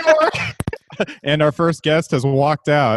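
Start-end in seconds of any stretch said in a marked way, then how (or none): 0.0-0.3 booming high announcer voice. Pretend. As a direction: announce it like live TV. Hosts open like a TV show.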